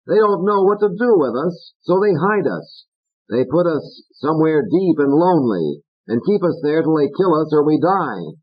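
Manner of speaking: high, biting puppet voice; Fats. Acted. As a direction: needle Corky. Fats argues with Corky.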